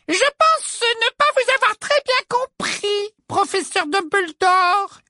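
put-on very high, sugary girlish voice; simpering little girl voice. A narrator's reading. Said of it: "d'un ton minaudant".

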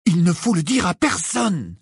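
high, emphatic, dry refusal; curt interruption. A narrator's reading. Harry cutting off his friend's advice, curt. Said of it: "l'interrompit sèchement Harry".

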